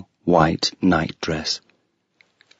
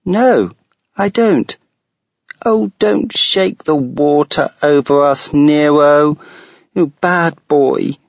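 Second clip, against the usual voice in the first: put-on a high child's voice scolding her dog; a little girl telling off her wet dog; 7 semitones higher, 5 dB louder than usual.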